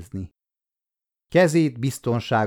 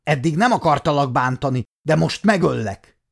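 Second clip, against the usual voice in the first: higher, louder threatening shout; shouting threat; 5 semitones higher, 3 dB louder than usual. Acted. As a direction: yell the threat at the girl with the sword raised. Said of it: "rákiáltott". (fenyegető kiáltás)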